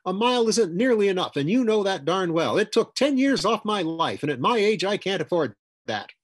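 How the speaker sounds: high, loud, heated tirade; fuming retort. A narrator's reading. Woman contradicting Roger about the near miss, fuming. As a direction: argue back loudly, pitch way up. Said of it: "She went on fuming".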